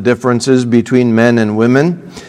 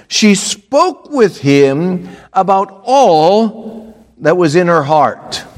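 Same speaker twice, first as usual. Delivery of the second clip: raised, measured Bible reading; scripture reading; 5 semitones higher than usual. Acted. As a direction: read the verse out. Reads scripture aloud.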